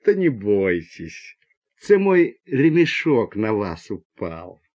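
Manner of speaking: low, slow, kindly Ukrainian lilt; gentle Ukrainian accent. A narrator's reading. Man from the top bunk reassuring passengers, gentle Ukrainian accent. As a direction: soothe them slowly and low in a Ukrainian accent. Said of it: "прозвучал ласковый украинский говорок".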